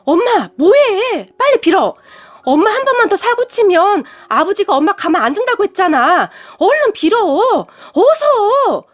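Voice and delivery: high-pitched, pleading insistence, climbing at the end; exasperated urging. A narrator's reading. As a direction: daughter whining at her mother to hurry. Exasperated daughter urges mother to beg. (높은 목소리로 애타게 재촉하는 말투)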